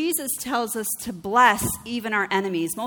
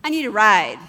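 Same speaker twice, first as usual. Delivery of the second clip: blunt request; 8 dB louder than usual. Retold or imitated. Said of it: "woman's request".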